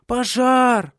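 put-on high, loud child's cry of alarm; children shouting. A narrator's reading. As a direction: yell the alarm like a frightened child. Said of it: "кричали они". (детский крик тревоги)

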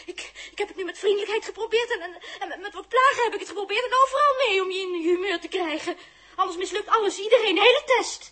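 loud, fast rant with bitter laughs; half-laughing shouting rage; furious tirade. Acted. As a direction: let him have it all at once.

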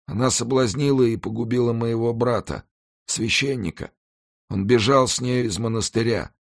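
high, pained pleading voice; anguished dying executioner. Acted. Dying executioner confesses to the monk, high and anguished.

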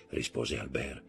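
plain reading voice

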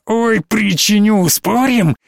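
put-on high, taunting possessed child voice; mock the parents like a gloating little monster; taunting demon child. Одержимая девочка дразнит отца. (дразнящий одержимый ребёнок, высоко)

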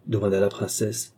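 ordinary delivery

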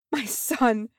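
high, anguished gasp. A narrator's reading. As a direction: gasp it out in grief. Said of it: "Mizem gasped".

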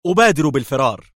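high, loud shout as she flees. Acted. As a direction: call out while speeding off. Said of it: "صائحة".

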